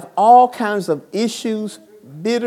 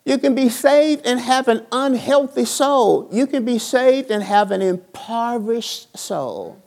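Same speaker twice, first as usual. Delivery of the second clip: raised, driving sermon voice; preacher building to a peak; preaching; 6 semitones higher than usual. Real, not acted. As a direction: preach it to the congregation. Preaching at full force.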